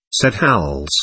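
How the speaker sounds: plain reading voice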